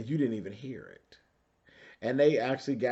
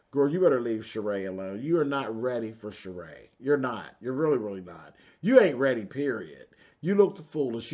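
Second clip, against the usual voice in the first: ordinary talk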